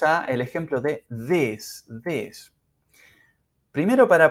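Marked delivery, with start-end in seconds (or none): 2.0-2.5 "this" with a short vowel, said softly; pronunciation model. Shown as a demonstration. Teacher models English for Spanish speakers, careful pronunciation. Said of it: "hablemos unos segunditos de la pronunciación".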